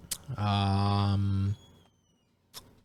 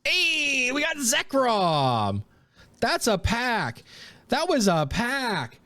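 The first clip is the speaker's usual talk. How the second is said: much higher, slow and elated; thrilled; 11 semitones higher, slower than usual. Real, not acted. Telling viewers about the Zekrom pull, slow high thrill. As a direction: savor every word of the big pull. Big hit pulled.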